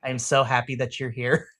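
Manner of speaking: low and tender, acted; gentle comforting. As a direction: console a tearful friend, calmly. Q comforting a bawling friend.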